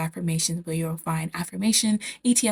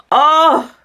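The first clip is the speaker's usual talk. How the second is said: high, loud delighted burst; excited oh; 9 semitones higher, 13 dB louder than usual. Real, not acted. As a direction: gush with sudden joy. Gushing about favourite technique.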